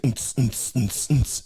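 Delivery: percussive vocal techno beat; imitated dance beat. Comic mimicking a rave DJ's track, beatboxed pulse. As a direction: spit out a club beat. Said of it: "It's like".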